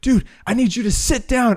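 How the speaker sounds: high-pitched mock yell; imitated screaming. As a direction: imitate an irate fan demanding it. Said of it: "He's screaming at the guy next to me".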